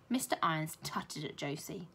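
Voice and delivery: ordinary delivery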